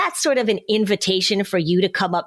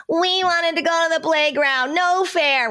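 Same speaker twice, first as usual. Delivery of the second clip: put-on much higher kid voice, complaining No fair; whiny disappointed child; 10 semitones higher than usual. Parent imitates a child upset about missing the playground, high whiny kid voice. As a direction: wail it like a kid denied the playground. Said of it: "and they're like".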